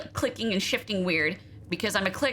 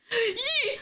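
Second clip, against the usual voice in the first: excited squeal. Real, not acted. Streamer squeals when her machine finally works. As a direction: gasp then a much higher happy squeal.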